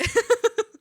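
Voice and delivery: a sudden loud cackle; loud high laugh. Real, not acted. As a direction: burst into laughter. Laughing about brothers' joke.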